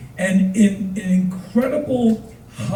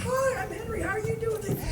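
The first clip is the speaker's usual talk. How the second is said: very high, very soft squeak; mock chipper greeting; 13 dB quieter than usual. Teller greeting a fan, high and soft. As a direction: chirp it tiny and sweet.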